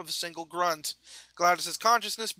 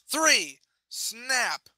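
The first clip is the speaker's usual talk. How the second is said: shouted; 5 semitones higher, 4 dB louder than usual. Acted. Count then bones snap.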